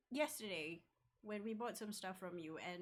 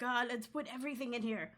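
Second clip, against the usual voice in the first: excited; 2 semitones higher, 7 dB louder than usual. Retold or imitated. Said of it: "you're like".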